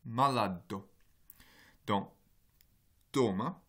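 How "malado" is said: malado with a held d: mala-ddo; long consonant demo. Shown as a demonstration. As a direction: lengthen the d in malado. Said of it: "allongement de la consonne qui suit".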